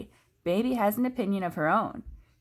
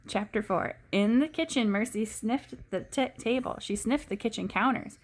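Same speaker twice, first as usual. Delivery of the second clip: ordinary delivery, nothing marked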